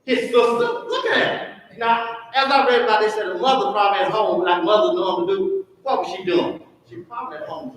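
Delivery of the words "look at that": high, slow, laughing wonder; delighted marvel. Real, not acted. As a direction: savour the discovery, drawn out. Preacher marvelling at scripture.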